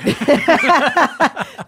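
high, loud peals of real laughter. Real, not acted. Co-host cracks up at the tight pants, laughing loudly. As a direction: burst out laughing.

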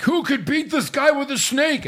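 high-pitched, swooping mock astonishment; mock hype. Co-host mocks the storyline's big question, hammed-up hype. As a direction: overplay the big dramatic question. Acting out hyped wrestling question.

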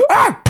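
sharp gasp into a loud, high "Ah!"; mock slap cry after an intake of breath; acted gasp and cry. Acting out the slap.

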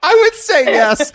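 high, playful; mock confident. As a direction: ham up the certainty. Mimicking a confident smoker.

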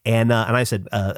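normal talking voice

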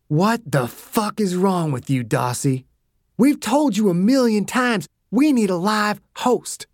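irritated rebuke that rises as it goes; exasperated scolding. A narrator's reading. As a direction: chew him out, mildly heated. Dew berates killer in quarrel.

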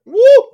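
loud, high "woo!"; excited whoop. Real, not acted. Co-host reacting to the leather strap moment, loud whoop. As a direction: let out a big whoop.

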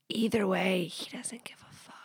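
hushed confiding whisper. Real, not acted. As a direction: drop to a breathy secret whisper. Aside confided to listener.

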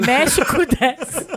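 laughing. Pretend. Joking repetition of phrase.

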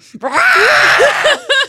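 very high, loud mock scream; imitated yell. Imagining friend in a silent theater, mock screaming. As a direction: blurt out a huge exaggerated shriek. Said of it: "you're just like".

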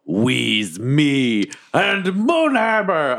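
high, drawn-out heroic declaration; battle cry. Acted. Player announcing the hero and Moonhammer, drawn-out battle cry. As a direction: stretch every word into a rising war cry. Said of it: "I go".

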